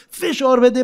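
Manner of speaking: فشار بده called out high and loud; a showy demonstration yell of فشار بده; demo shout. Shown as a demonstration.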